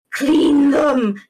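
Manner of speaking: CLEAN THEM, loud and drawn out; emphatic plea. Real, not acted. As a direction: stretch out "clean them" as an exasperated order. Exasperated plea repeated for emphasis.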